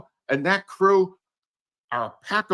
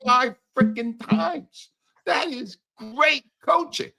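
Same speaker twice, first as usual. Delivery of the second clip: slow, higher, dripping with sarcasm; sarcastic praise; 7 semitones higher, slower than usual. Real, not acted. Host mocks the Eagles staff with slow sarcastic praise. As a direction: drag it out like a backhanded compliment.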